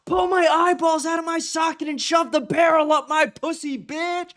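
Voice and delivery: very high, hammed-up villain line; high mock villain voice; shrill villain quote. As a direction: shriek it like the unhinged killer. Quoting movie villain.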